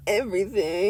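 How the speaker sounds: low, soft sniveling everything; mock sniveling. Shown as a demonstration. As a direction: snivel it out reluctantly. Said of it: "That's exactly what it sounded like".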